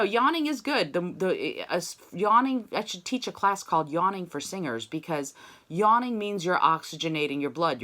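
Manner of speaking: ordinary talk, nothing marked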